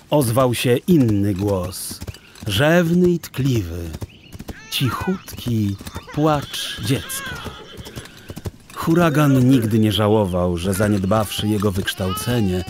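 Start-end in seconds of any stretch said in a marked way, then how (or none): none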